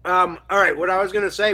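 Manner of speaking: talking around ice; chewing while talking. Real, not acted. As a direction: chew ice while speaking. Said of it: "Sorry, I'm chewing some ice".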